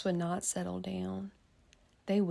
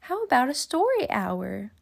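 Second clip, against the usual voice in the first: higher, bright inviting offer; cheery offer; 6 semitones higher, 7 dB louder than usual. Acted. Miss Nelson offering story hour, higher and inviting. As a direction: offer it like a treat.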